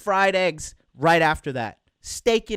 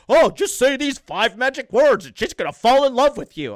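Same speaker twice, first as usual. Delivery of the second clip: louder, higher mock sales pitch; mocking idiot coaches; 4 semitones higher, 5 dB louder than usual. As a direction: parody a coach peddling magic words. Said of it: "idiots, they're like".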